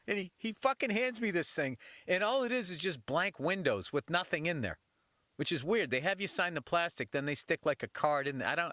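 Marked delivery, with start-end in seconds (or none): none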